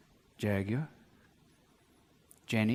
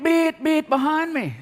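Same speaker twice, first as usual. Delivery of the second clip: mock horn; 12 semitones higher, 4 dB louder, slower than usual. Imitating car horn.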